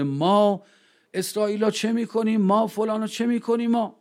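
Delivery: high mock rallying yell, no real volume.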